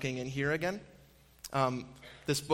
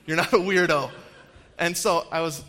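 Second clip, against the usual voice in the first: louder, laughing through the words; quoting him while laughing; 2 semitones higher, 7 dB louder than usual. Real, not acted. Laughing recalling the line.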